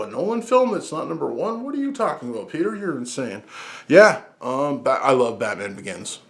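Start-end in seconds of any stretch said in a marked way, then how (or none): 0.0-3.4 high, softened voice of disbelief, dropping at the end; mock shocked viewer. Imagined viewer challenging his ranking, mock disbelief. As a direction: play an incredulous objector, high but held back.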